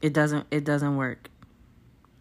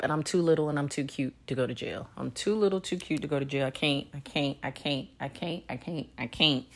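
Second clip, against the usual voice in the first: soft; 5 semitones lower, 8 dB quieter than usual. Real, not acted. Joking about going to jail.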